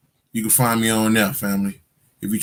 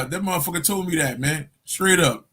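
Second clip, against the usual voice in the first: normal talking voice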